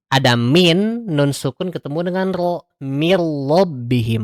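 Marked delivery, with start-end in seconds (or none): 2.8-4.2 slow, "mir rabbihim" with the n merged into r; recited example. Shown as a demonstration. Teacher demonstrates idgham, slow Quranic recitation. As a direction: draw it out, nun melted into the ra. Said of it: "dibacanya dengan lebur".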